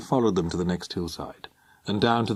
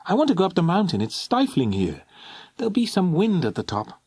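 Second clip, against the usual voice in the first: put-on a lightly raised, petulant teenage girl; girl's voice; 9 semitones higher than usual. A narrator's reading. Alison pleading to climb the mountain, lightly voiced girl. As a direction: whine it a little, bored and hot.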